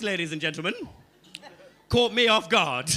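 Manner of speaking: ordinary talk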